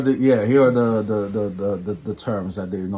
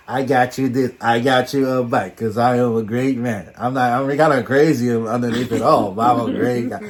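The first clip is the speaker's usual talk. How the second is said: boastful impression; 3 semitones higher, 7 dB louder than usual. Imitating Vinny's boasting.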